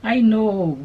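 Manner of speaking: usual speaking voice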